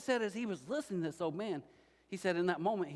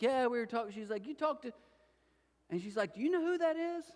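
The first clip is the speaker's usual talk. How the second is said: much higher, eager waitress voice; high, gushing waitress asking; excited waitress; 9 semitones higher than usual. Retold or imitated. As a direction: play the waitress bursting with the news.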